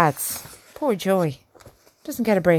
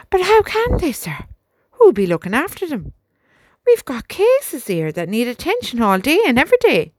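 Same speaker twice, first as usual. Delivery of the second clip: sergeant pleading higher, louder and urgent; worried sergeant protesting; 7 semitones higher, 4 dB louder than usual. A narrator's reading. Sergeant protesting to the major, higher and louder. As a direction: push back hard on the officer's bad news.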